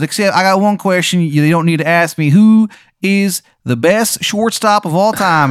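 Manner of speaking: high, loud, hammed-up Maryland accent; overdone Maryland accent, loud and high; terrible Maryland accent. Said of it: "His accent is so bad".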